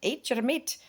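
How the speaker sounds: high, bouncy mock-soothing voice, rising on meat; hammed-up reassuring coach pushing meat; imitating her advice. Carnivore vlogger coaching an overwhelmed Bill, mock soothing. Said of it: "giving Bill Knott in Alaska some tips like".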